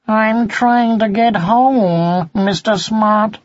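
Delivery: polite little cat pleading; kitten voice. Acted. Kitten answers the fox.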